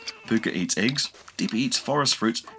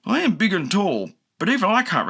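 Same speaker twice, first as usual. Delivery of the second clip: gentle giant voice, raised; a big friendly creature talking; big dinosaur; 11 semitones higher, 3 dB louder than usual. A narrator's reading. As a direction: dinosaur character voice. Voices Dippy.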